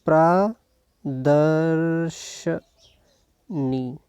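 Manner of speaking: प्रदर्शनी extremely slow, with long gaps; pra... dar-sh... nee, drawn out in प्रदर्शनी; syllable by syllable. Shown as a demonstration. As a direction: stretch each syllable of प्रदर्शनी while writing it. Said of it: "ऐसे लिखते हैं".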